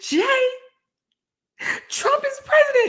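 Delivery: high loud urgent calling; mock panicked shout at husband; urgent wake-up call. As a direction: shake him awake with the news. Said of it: "I'm like".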